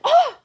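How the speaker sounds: sharp, very loud high yelp; pained yelp. Retold or imitated. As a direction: yelp out as the lash is yanked. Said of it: "I said".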